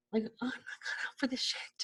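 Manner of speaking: breathy whispered aside under her breath; hushed, half-joking whisper to the camera. Real, not acted. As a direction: drop to a whisper for the confession. Muttered aside to herself.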